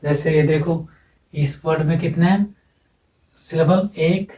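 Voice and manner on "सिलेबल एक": usual speaking voice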